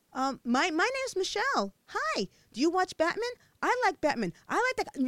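high, hopeful chatter; eager friendly try. A narrator's reading. Michelle trying to befriend the bully, high eager chatter. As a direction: try hard to sound friendly.

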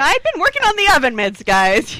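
high, loud mimicked protest; mock protest. Co-host imitating Sonia defending her toaster oven project, mock protest.

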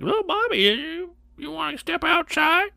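sing-song high prissy teacher imitation. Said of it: "the teacher was all like".

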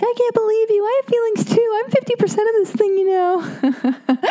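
hammed-up wounded pleading; whiny hurt girlfriend. Mock-sobbing at her boyfriend over his threats to leave. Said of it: "And I'm like".

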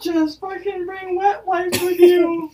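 high, slow, shouted exasperation; exasperated shout. Real, not acted. Telling her friend to just bring wet wipes, shouting in exasperation. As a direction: yell the advice, fed up.

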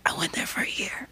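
hushed whisper into the mic. Real, not acted. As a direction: whisper it like a secret. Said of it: "if you whisper into the mic".

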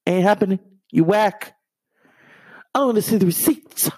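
Whitney turning Wendy down, high and sassy; sassy diva refusal. Retold or imitated. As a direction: brush off Wendy with mock attitude.

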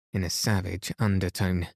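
plain reading voice

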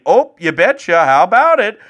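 high, bright hammed-up Midwestern Minnesota accent; mock Midwest accent. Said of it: "Everyone going around saying".

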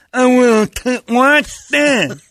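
put-on high, loud little kid; squeaky eager kid daring back; hammed-up kid.

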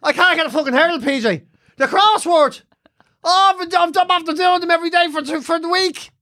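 high, moaning lament; mock-distraught mum. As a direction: bewail a tiny crisis as the mother. Mother lamenting missed crossword.

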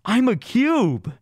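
high, slow, drawn-out imitation of a freaked-out friend; tripping friend insisting. Friend insists he turned into cube.

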